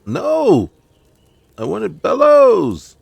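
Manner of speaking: high, loud cry of dismay, slowing on the last words; frustrated shout. Real, not acted. Gamer outburst at missed step.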